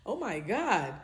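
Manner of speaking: usual speaking voice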